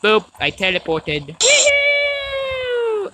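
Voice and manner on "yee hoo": very high playful yell; excited whoop. Real, not acted. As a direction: holler for fun. Teleports and cheers.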